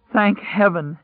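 high-pitched cry, choked with relief; choking with emotion. Acted. Stranger cries out in relief at finding people, high and choked. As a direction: blurt it high, near tears with relief. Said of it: "high-pitched and choking with emotion".

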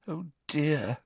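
a hushed, stricken murmur. A narrator's reading. Hermione after Hagrid slams the door, stricken. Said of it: "looking stricken".

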